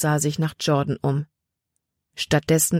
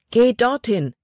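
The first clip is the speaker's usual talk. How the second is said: high-pitched urgent order; urgent command; 7 semitones higher, 3 dB louder than usual. A narrator's reading. Jordan points Libby to other stones, commanding urgently. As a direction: send her across, pitched up.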